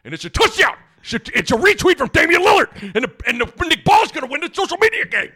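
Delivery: high, loud touchdown call; excited play-by-play. Pretend. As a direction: yell it like a stadium announcer. Mock football play-by-play.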